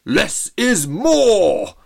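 very slow, loud, high booming cry; bellow. Acted. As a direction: bellow it to the crowd. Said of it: "Trevor bellowed".

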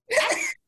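high loud shriek of laughter. Real, not acted. Cohost cracking up at the Marshalls joke, shrieking laugh.

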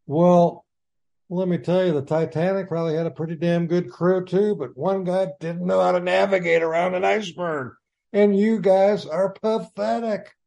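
high-pitched, worked-up tirade, pushing the words out; exasperated rant. Real, not acted. Ranting at the team's owner.

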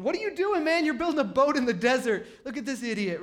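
high, loud mock jeer; scoffing. Onlookers jeer at Noah building a boat, scoffing. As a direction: hoot at him like a mocking onlooker. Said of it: "laughing at him, scoffing".

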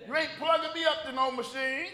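high, loud protest; hammed-up indignant objection; comic stubborn refusal. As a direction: refuse it with mock outrage. Acted refusal as old self.

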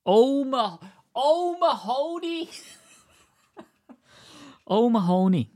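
"O'Mahony" as oh-ma-HOE-nee, very high; high, drawn-out joke voice on "O'Mahony"; mock mispronunciation. Shown as a demonstration. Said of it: "names that are mispronounced".

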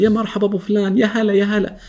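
high, effusive mock welcome; exaggerated welcome. Welcoming the show-off colleague with overdone cheer. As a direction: greet him like a long-lost star. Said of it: "لما يوصل".

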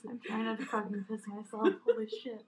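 breathless real laughter; can barely get words out for laughing; laughing hard. Real, not acted. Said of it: "I'm laughing, like, so hard".

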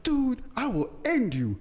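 high, drawn-out mock threat; acting out a furious threat; retold fury. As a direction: stretch it out as a high-pitched furious warning, retold for laughs. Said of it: "I was furious".

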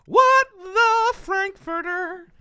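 very high, drawn-out mock exclamation; loud, slow, comically overblown shock; mock outrage. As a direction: stretch every word in hammed-up disbelief. Mock-acting out WTF from the text.

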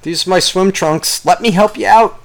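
high-pitched mock helpful stranger; hammed-up offer of help; galoshes-wearing rescuer. Stranger in galoshes offers help in a flood, high mock-eager voice. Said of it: "Just be like".

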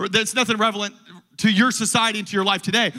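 usual speaking voice

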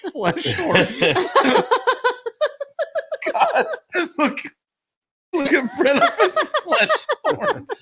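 laughing hard. Real, not acted.

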